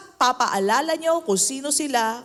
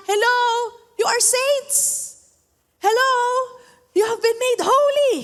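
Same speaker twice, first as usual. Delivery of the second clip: Paul reminding them; 9 semitones higher than usual. Retold or imitated. Preacher voicing Paul reminding the Corinthians, high and bright. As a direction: playfully call the church back to who they are. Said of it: "Nire-remind niya".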